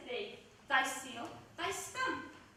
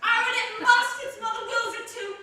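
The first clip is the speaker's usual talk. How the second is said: much louder and higher on Aaron's name; loud insistence; 5 semitones higher, 9 dB louder than usual. Acted. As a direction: demand it over his objection. Nurse insists the child must die.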